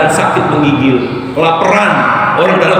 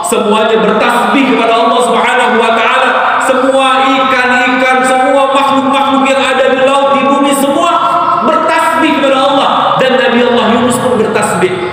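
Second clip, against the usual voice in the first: high, building, sing-song sermon; fervent preaching; 7 semitones higher than usual. Acted. Preacher exalting creation's praise to listeners, fervent. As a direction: lift the voice in praise.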